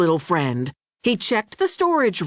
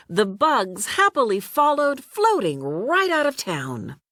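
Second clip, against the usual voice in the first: ordinary delivery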